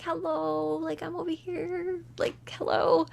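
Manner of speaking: lightly voiced cute Bigfoot greeting; happy waving Bigfoot. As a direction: wave and call sweetly to a friend. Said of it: "He's like".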